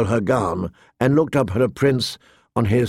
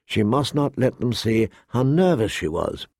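plain reading voice

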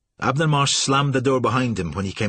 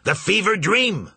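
a high, slow bellow, red in the face; 10 semitones higher, 4 dB louder, slower than usual. Acted. Captain bellowing at the startled agent. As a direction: roar the boat's name at the agent. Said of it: "Marsh bellowed".

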